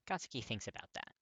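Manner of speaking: ordinary delivery, nothing marked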